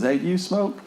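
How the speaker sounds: high, bright call to a worker; mock manager hailing an employee. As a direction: toss the question up high, casual boss. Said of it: "the general manager goes".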